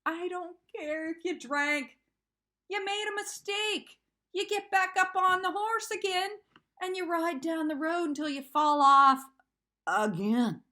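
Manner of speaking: begging him to understand; upset pleading. Real, not acted. Continuing breakdown rant.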